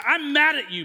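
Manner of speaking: mock annoyed. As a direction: play up a friend's grievance. Retold quarrel with friend Ed.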